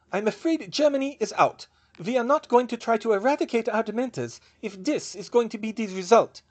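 put-on high, firm German-accented refusal; thick German accent. A narrator's reading. German witch pulling out of the plan, thick German accent. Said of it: "she said in a thick accent".

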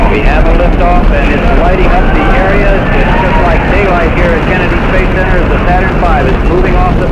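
loud, high, thrilled liftoff call; excited. Real, not acted. Launch commentary at liftoff.

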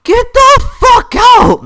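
high, slow, all-out shout; screaming rage. Real, not acted. Player at breaking point with spammer, screaming rage. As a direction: scream each word at the screen.

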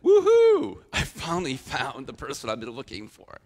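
loud, high whooping cheer, then settling; excited whoop. Retold or imitated. First man cheering over the first woman, excited whoop. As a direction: shout it out like you've finally found her. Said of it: "it's something of really excitement".

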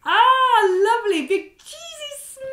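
high, loud delighted gasp of joy; delighted face. Shown as a demonstration. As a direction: show the children a big happy face.

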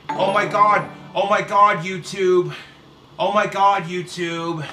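loud hammed-up gasping oh my God; mock outrage. Vlogger mocking outrage to YouTube viewers, loud hammed-up exclamation. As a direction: overplay the shock for laughs.

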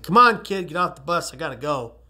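lightly acted bus driver, starting high and loud; typical Muni driver. Driver hurrying a kid off the bus, lightly imitated. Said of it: "a typical San Francisco Muni driver".

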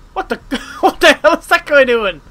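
loud, high, cracking up; laughing disbelief. Real, not acted. Player baffled by a game character, laughing shout.

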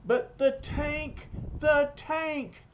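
very high, slow wail; panicking. A narrator's reading. Khrushchev frets at Vanko about the tank, panicking. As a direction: stretch the words in high-pitched mock terror.

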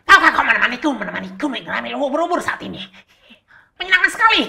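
high, loud cartoon sponge voice; hammed-up squeaky SpongeBob. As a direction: chirp it like an eager cartoon sponge. Playing SpongeBob talking to Patrick.

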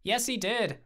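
high, emphatic comeback; insistent contradiction. A narrator's reading. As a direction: argue back like a toddler. Childish back-and-forth argument.

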